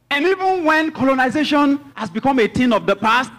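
high, loud pulpit declamation; full-force preaching. Real, not acted. Preacher telling congregation about Britain's empire, full-force preaching.

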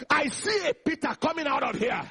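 rousing pulpit proclamation; full-force preaching. Real, not acted. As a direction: lead the crowd phrase by phrase, building to a shout. Leads congregation in a declaration.

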